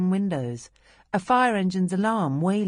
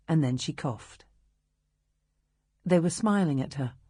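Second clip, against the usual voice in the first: usual speaking voice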